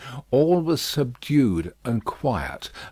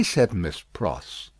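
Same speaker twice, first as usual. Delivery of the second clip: ordinary delivery, nothing marked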